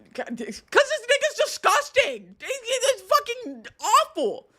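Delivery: high, squeaky exasperated rant; disgusted outburst. Real, not acted. Reacting to disgusting messages, high-pitched outraged disgust. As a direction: blurt it out in appalled disbelief, pitch shot way up.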